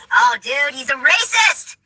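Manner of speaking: hammed-up outraged heckler; mock outrage. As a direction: mimic a scandalized accuser, stretching the last word. Mimics an outraged accuser.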